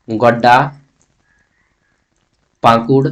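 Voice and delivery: ordinary delivery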